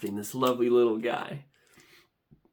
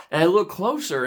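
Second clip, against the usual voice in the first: ordinary delivery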